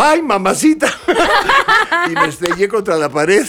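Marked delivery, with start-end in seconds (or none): 0.0-0.9 much higher, laughing cry of surprise; hammed-up startled cry, laughing; mock yelp while falling. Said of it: "salgo volando y digo". 1.0-2.0 high burst of real laughter; laughs. Real, not acted. Laughs at his own story.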